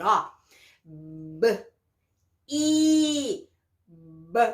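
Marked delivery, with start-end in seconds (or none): 0.8-1.7 B said as a soft lip pop, no vowel after it. Shown as a demonstration. Teacher showing children the B sound as a little lip pop. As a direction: pop the lips for B like a tiny burst. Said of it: "qual sonzinho que as duas juntas vão fazer".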